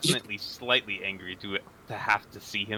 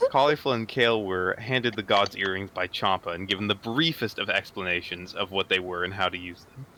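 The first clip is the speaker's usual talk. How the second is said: ordinary talk, nothing marked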